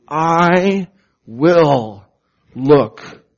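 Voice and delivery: "I will look" loud and deliberate, "I" stretched; forceful, spaced-out stress on "I will look"; emphatic I. Shown as a demonstration. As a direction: punch "I", then land hard on "will" and "look". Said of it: "it's emphatic in the Hebrew".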